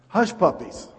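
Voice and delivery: high-pitched imitated recommendation; voiced pastor. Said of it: "my pastor from Jacksonville says".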